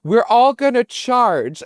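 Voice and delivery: high, slow, commanding tour guide; stern guide voice. Retold or imitated. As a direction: boss the seal around from up high. Guide scolding a seal, high.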